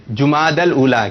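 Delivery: "جماد الاولا" as jumaadal-oola, unhurried; correct pronunciation. Shown as a demonstration. Host giving the audience the answer, "جماد الاولا" pronounced jumaadal-oola. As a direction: model "جماد الاولا" with ju- and -dal. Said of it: "اور صحیح تلفظ کیا ہے".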